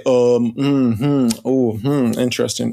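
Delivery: mock pondering professor; thinking hmm. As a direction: hem and haw like a stuffy academic. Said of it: "They'd say".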